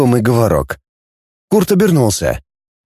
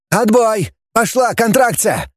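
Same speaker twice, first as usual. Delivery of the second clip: high, excited announcement; shouting; 8 semitones higher than usual. A narrator's reading. Scientist bouncing by the screen as it starts, excited shout. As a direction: call it out urgently. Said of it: "закричал Тенин".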